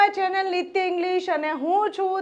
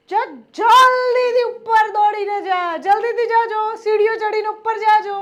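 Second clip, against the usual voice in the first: loud, raised, hurrying command; urgent order; 3 semitones higher, 5 dB louder than usual. Acted. Sending someone running up the stairs, urgently. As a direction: rush them along with a higher, louder voice.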